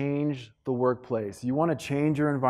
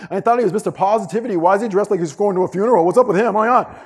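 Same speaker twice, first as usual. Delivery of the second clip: fast, loud, higher-pitched griping; mocking gossip; 6 semitones higher, 6 dB louder, faster than usual. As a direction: gossip scornfully, rattling it off louder and faster. Acting out hallway gossip about him.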